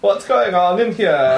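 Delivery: put-on slow, theatrical German accent; camp German. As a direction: ask it in a campy German voice. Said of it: "super camp in German".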